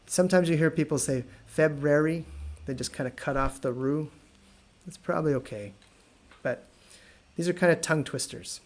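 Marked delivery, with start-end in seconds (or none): none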